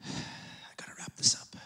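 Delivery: soft unvoiced whisper to self; a sigh, then a private whispered note; whispered aside. Real, not acted. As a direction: whisper it, half to yourself. Aside to self mid-sermon.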